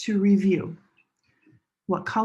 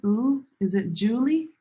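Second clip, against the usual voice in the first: ordinary talk, nothing marked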